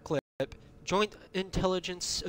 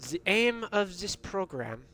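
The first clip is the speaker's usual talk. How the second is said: put-on horrible German-American accent; 3 semitones higher than usual. Gamer reading a secret document aloud, bad German-American accent. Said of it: "a horrible German-American accent".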